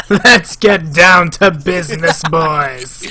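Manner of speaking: loud, drawn-out jokey declaration; mock business-like announcement. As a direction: stretch it out loudly for the laugh. Jokey line about alien women.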